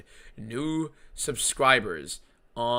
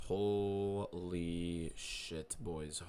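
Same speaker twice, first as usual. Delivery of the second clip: a very slow, stretched-out Holy shit; drawn-out amazement; 4 semitones lower, 10 dB quieter, much slower than usual. Real, not acted. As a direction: let the holy hang in disbelief. Streamer stunned by follower count.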